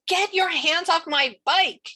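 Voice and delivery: high, loud, drawn-out demand; angry yell. A narrator's reading. Narrator as girl to bike thief, angry high yell. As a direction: yell it at someone stealing from you.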